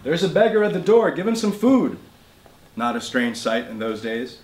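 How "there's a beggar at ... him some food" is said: a raised, higher call across the house; shout to mother. Retold or imitated. Boy shouting to his mother about a beggar, loud call. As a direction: call out to mother in the next room. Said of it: "so I shout to my mother".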